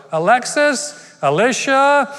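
loud, high calling out to the Echo Dot. Retold or imitated. Wife calling loudly to the Echo Dot, high and loud. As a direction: call the name up high and loud, twice. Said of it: "and go".

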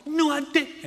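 very high, loud mock outrage; protesting denial. Brother protesting his sister's claim, indignant. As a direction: squawk an exaggerated denial.

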